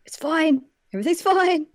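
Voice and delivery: high, strained cover-up; mock panicked reassurance. Co-host voices flustered sister covering up.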